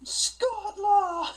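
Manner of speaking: high mock war shout; battle cry. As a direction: yell it like a rallying call.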